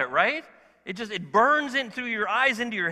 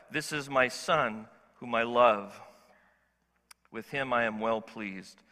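lower, slow and solemn; lower, slow voice of the Father from heaven; voice of God; 8 semitones lower than usual. Acted. The Father speaks from heaven over his Son, low and solemn.

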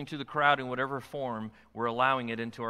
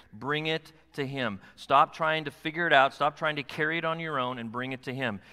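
ordinary talk

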